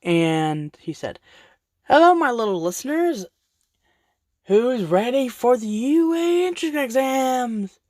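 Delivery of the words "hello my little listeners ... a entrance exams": high, bright greeting to a crowd; cheery announcer voice. Acted. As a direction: welcome them like a showman. Principal greeting students on stage.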